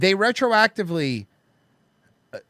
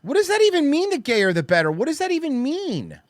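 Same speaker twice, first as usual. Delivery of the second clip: high, pitched-up disbelief; what on earth, voice jumping up; incredulous; 10 semitones higher than usual. Real, not acted. Incredulous reaction to clip.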